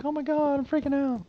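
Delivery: high, hammed-up panicked squeal; mock panic. Player mimicking someone startled by the ticking, high mock panic. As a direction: play the player who is freaking out. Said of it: "You're like".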